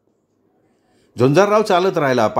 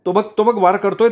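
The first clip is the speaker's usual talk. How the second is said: panicked; 6 semitones higher than usual. A narrator's reading. Panicked man describes nightmare attack.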